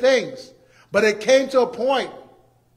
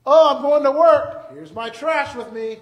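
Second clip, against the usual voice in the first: bright, louder, higher mock cheer; mock cheerful commuter; 6 semitones higher, 7 dB louder than usual. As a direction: play a proud man off to work with his trash. Preacher acting out carrying trash.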